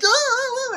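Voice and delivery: very high-pitched squeal; mock startled cry. Viewer retelling a character's slip, mock startled cry. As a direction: let out a high "ah" as she nearly slips. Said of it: "and she's like".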